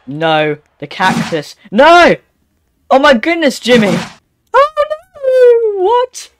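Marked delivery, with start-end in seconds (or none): 1.7-4.0 high, loud cry of dismay; alarmed yell. Real, not acted. Player to Jimmy as the game turns bad, alarmed yell. 4.5-6.3 startled cry that breaks into a squeak; voice crack. Real, not acted. Player crying out in dismay at the game, voice cracking. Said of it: "What is that voice crack?".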